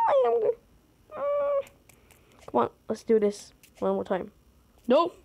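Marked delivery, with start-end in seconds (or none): 0.1-1.7 high whining sounds; high frustrated whimper; high dismayed whine. Real, not acted. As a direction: squeal in dismay. Dismayed after fail.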